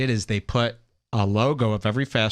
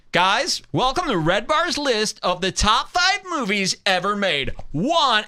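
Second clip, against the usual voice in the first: higher, self-important list announcer; mock authority; 7 semitones higher than usual. Magazine host presenting a top five list, in a pompous announcer voice. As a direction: announce it like it's gospel. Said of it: "they say it with authority".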